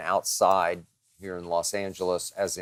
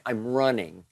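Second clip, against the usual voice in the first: ordinary talk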